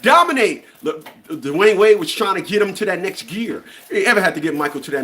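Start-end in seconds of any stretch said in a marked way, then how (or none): 0.0-0.6 high, loud shouted command; retold yell. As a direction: bark it like a teammate pushing him. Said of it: "had to yell at him".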